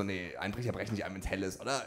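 sleepy mumble. Retold or imitated. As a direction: murmur it to yourself in bed. Half-asleep musing in bed. (leises, tiefes Murmeln im Halbschlaf)

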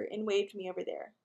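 ordinary delivery, nothing marked

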